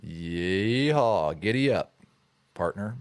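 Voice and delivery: drawn-out Texas cowboy drawl; hammed-up Texas yeehaw; Texas accent. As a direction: play a Texan cowboy, stretching the yeehaw. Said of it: "Texas accent?".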